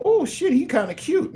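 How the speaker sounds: very high-pitched swoony admirer; put-on falsetto, checking someone out; smitten admirer voice. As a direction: squeal it like someone secretly smitten.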